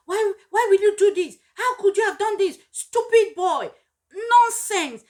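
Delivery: high, loud, insulting yell; abusive shouting. Retold or imitated. Parent yelling insults at a child who erred, furious. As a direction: berate the child harshly. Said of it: "you begin to abuse this child".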